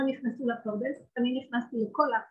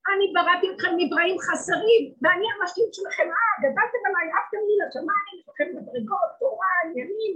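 loud, high divine rebuke; lightly voiced God reproaching his creatures; 6 semitones higher, 6 dB louder than usual. God rebuking the proud creations, loud and high. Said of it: "תגידי לקצה".

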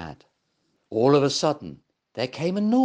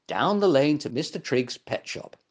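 ordinary delivery

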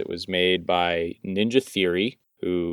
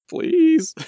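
pleading squeak; 3 dB louder than usual. Pretend. Mock begging for PlayStation games.

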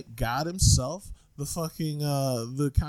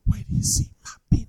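whisper; 5 semitones higher, faster than usual. Acted. Whispered quick.

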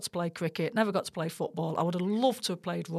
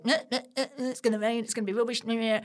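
higher-pitched whingeing naysayers; mock grumbling, pitched up; mocking Londoners; 4 semitones higher, faster than usual. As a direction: imitate Londoners moaning in meh noises. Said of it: "you had all the Londoners going".

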